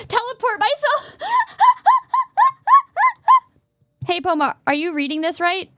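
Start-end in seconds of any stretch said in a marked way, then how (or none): 0.1-1.1 high, gasping exclamation; incredulous outburst. Real, not acted. Streamer amused at saying teleport myself, incredulous outburst. Laughing at own odd phrase. 1.2-3.4 loud, very high peals of laughter; high cackling laugh. Real, not acted. Streamer cracking up at their own phrase, high cackle.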